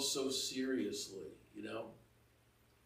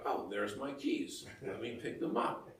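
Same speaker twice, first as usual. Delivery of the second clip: relaxed, softer, easy pace; calm; 5 dB quieter than usual. Pretend. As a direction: shrug it off gently. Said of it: "you're like".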